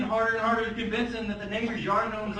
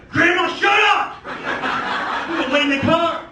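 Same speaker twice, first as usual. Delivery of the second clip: exasperated shout; 7 semitones higher, 8 dB louder than usual. Acted. Grandson to his heckling grandma, exasperated shout. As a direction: yell it to shut her up.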